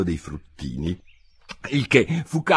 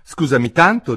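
put-on raised, apologetic little-girl voice; humble, higher-pitched apology; 5 semitones higher, 7 dB louder than usual. A narrator's reading. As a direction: apologise meekly but audibly. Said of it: "disse Alice con ogni umiltà".

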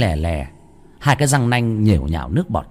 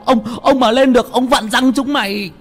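high furious shout into radio; angry yelling; 14 semitones higher than usual. Acted. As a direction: bark it down the walkie-talkie. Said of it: "quát vào bên trong bộ đàm".

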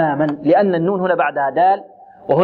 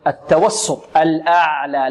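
ordinary talk

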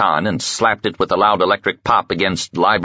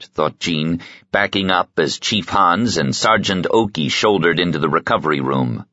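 ordinary delivery, nothing marked

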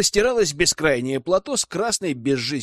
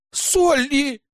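much higher, louder indignant cry of a name; indignant shout; 12 semitones higher, 4 dB louder than usual. A narrator's reading. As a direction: shout her name in outrage. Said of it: "хором возмущались девушки".

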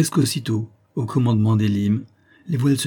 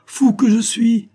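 high, loud, drawn-out cry; self-reproaching exclamation; 13 semitones higher, 6 dB louder, slower than usual. A narrator's reading. Elim realises his own mistake, exclaiming at himself. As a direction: exclaim slowly at your own folly.

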